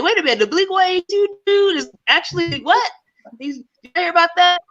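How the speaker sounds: high-pitched retold disbelief; mock incredulous. Comedian retelling reaction to Blink one eighty-two news, mock incredulous. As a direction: play up the double take, pitched high.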